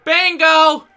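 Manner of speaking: loud, high yell of bingo; a bingo player yelling over the promo; shouted bingo call. Retold or imitated. Old lady in the crowd shouting bingo, loud.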